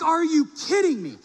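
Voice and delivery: high, loud disbelief; can't believe the drawer is locked; exasperated disbelief. Retold or imitated. Pastor finding the phone drawer locked, high and exasperated.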